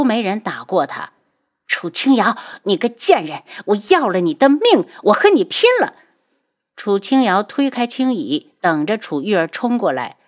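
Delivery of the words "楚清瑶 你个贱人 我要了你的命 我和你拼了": rising to a shrieking high, loud cry; hysterical rage. Acted. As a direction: scream and charge at her. 楚玉儿挨打后扑来. (歇斯底里地尖叫)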